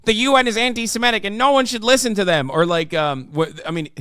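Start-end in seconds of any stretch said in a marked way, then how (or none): 0.0-2.5 mock indignant, rushed and high; Ben Shapiro. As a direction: imitate a fast, high, angry rant. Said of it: "Ben gets angry, where he's like".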